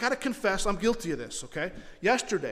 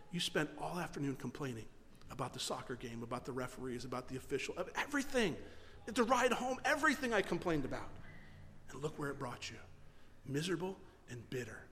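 softer, gentle rebuke from within; hushed voice of conscience; a soft, low-key voice of God; quiet inner voice; 5 semitones lower, 7 dB quieter than usual. Retold or imitated. Retells the Lord's rebuke.

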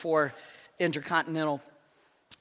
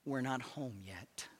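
gently; 5 semitones lower, 14 dB quieter than usual. A narrator's reading. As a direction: hush it down like a wife comforting. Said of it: "said gently to her husband".

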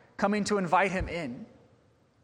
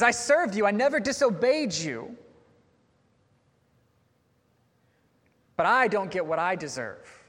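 aggrieved; 3 semitones higher than usual. Retold or imitated. Older son's angry complaint voiced.

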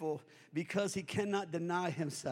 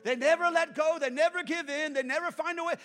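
ordinary talk

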